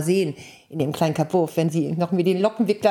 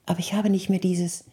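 normal talking voice

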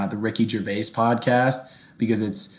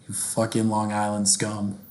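usual speaking voice

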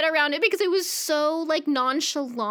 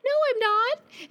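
much higher, slowed mock protest; high denial; 11 semitones higher than usual. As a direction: squeak out a stubborn denial. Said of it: "And she's like".

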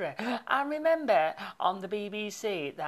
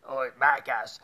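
put-on a lower, louder try at a Spanish accent on "Oh, Marcus"; bad Spanish accent; 6 semitones lower, 4 dB louder than usual. DJ retrying the soap actress's line in a clumsy Spanish accent. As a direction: fumble a Spanish accent, deeper this time. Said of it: "but I can't do a Spanish accent".

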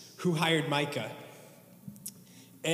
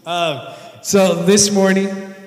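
stage fright; 3 semitones higher, 12 dB louder, slower than usual. Real, not acted. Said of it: "Sorry, I'm nervous".

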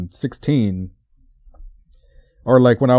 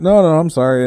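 louder, higher hurried-to-reassure apology; flustered retold apology; 6 semitones higher, 6 dB louder than usual. Driver apologising to the woman who watched the parking, flustered high apology. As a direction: replay an embarrassed apology, voice jumping up.

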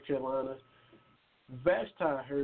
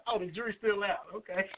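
talking through laughter, higher and softer; amused; 4 semitones higher, 3 dB quieter than usual. Real, not acted. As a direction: chuckle out a playful comeback. Host laughs at guest's teasing.

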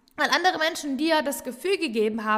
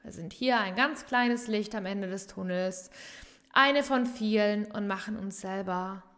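ordinary delivery

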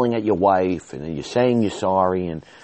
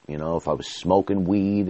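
usual speaking voice

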